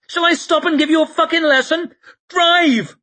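high, loud, fast angry yell; angry shout. Acted. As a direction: snap the order at him. Shai yells at Temple.

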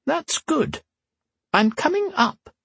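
put-on high creaky old empress, dry; old woman voice. A narrator's reading. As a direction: tart old woman's retort. Whisper's voice held.

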